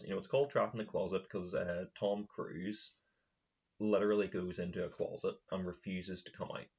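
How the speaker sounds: usual speaking voice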